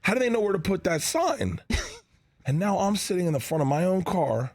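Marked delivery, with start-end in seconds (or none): 0.0-1.6 lightly voiced questioning kid, higher pitch; daughter's question. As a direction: imitate a daughter reasoning with dad, mildly. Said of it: "She goes, "Well, Dad, I'm just saying".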